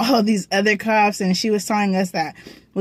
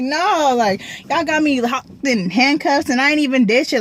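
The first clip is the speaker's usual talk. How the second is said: higher-pitched retold protest; mock-distressed objection, pitched up; upset protest; 5 semitones higher than usual. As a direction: protest your innocence in a higher voice. Retold crying protest while handcuffed.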